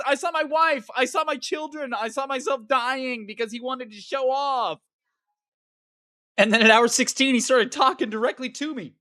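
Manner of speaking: ordinary delivery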